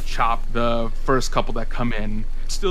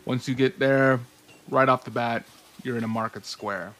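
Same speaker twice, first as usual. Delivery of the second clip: scene setting; 6 dB quieter than usual. Acted. Game master describes arrival.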